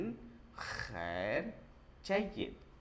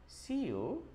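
ordinary talk

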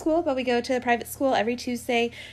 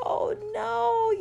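mock dismay; 11 semitones higher than usual. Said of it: "And I was like".

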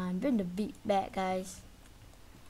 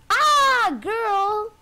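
a sudden, much higher and louder shriek of fright; startled scream; 11 semitones higher, 12 dB louder than usual. Real, not acted. Gamer caught by granny, screaming in fright. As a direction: yelp as she jumps out at you.

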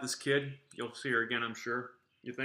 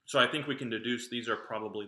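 speaking between bites; talking while chewing. Real, not acted. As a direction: mouth partly full of candy. Seen 1: bites candy at -1, talks holding bitten piece, mouth working.

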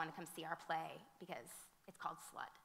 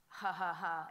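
mock joking laugh; 8 dB louder, slower than usual. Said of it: "this is how he said it to me".

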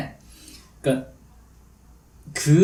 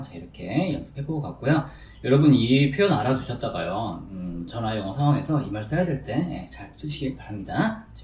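ordinary talk

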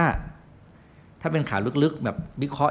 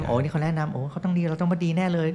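higher-pitched imitation of an eager, trusting buyer; mock gushing fan; 5 semitones higher than usual. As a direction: play a customer instantly sold. Imitating trusting fans of a liked seller.

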